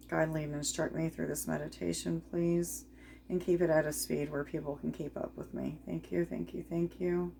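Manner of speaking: soft, hushed invocation with pauses; prayer. Real, not acted. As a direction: pray quietly, pausing between requests. Prays to spirit before meditating.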